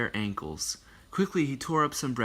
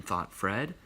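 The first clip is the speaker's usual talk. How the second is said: ordinary delivery, nothing marked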